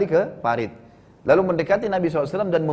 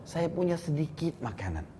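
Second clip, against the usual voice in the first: much softer, discreet aside to the Prophet; confiding near-whisper; 10 dB quieter than usual. Retold or imitated. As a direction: confide it quietly, as if others mustn't hear. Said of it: "ingin membisikkan Nabi". (berbisik pelan supaya tak terdengar orang lain)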